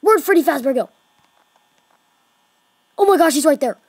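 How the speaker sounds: high, loud alarm; panicked search. Real, not acted. Gamer shouting while hunting Freddy. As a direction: yell as he finds Freddy.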